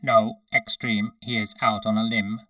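loud robotic monotone reply; synthetic cyborg soldier; metallic synthesized voice. A narrator's reading. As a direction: answer like a machine. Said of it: "in his metallic synthesized voice".

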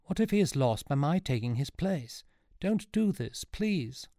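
high-pitched urgent entreaty; distressed, rising plea; pleading. A narrator's reading. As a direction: beg him to stop. Sean pleads for his grandfather.

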